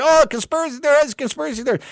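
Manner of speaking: high, sing-song mock of the critics; mocking sneer. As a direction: toss it off like a dismissive pundit. Mocking the critics' dismissive line.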